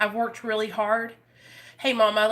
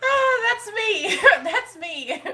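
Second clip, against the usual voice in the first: high laugh, slow, teary; cracking up at her own joke; laughing at herself; 11 semitones higher, 4 dB louder, slower than usual. Real, not acted. Comparing herself to the Wonka kid, laughing.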